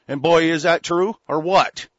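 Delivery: loud rant at the listeners; loud emphatic. Real, not acted. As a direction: hammer it home loudly.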